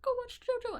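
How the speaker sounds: high, loud quoted insistence; imitating the ex. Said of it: "they were like".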